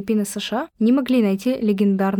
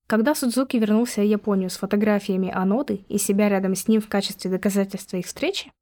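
ordinary talk, nothing marked

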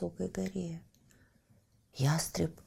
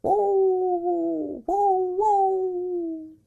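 high drawn-out pup howling; puppy howl; 12 semitones higher, much slower than usual. Acted. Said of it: "малыш громко завыл". (щенячий вой)

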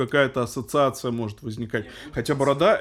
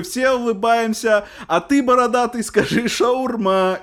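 high, loud, slow taunt; drawled smug joke at someone; mocking; 9 semitones higher, 5 dB louder, slower than usual. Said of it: "и он такой".

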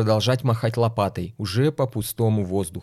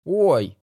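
high yelp from a hit; pained cry; 5 semitones higher than usual. Acted. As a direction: yelp as if struck by a switch. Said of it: "получил удар прутиком".

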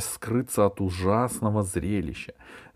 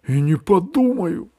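high, loud huffy refusal; stubborn refusal; 11 semitones higher, 7 dB louder than usual. A narrator's reading. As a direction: refuse flatly, offended. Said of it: "сказал дракон".

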